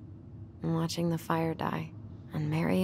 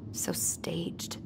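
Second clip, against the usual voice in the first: soft whisper, much softer, bitter; 9 dB quieter than usual. Real, not acted. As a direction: let it slip out on a breath. Bitter remark over old photos.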